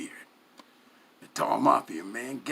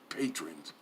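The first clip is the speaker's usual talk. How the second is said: ordinary delivery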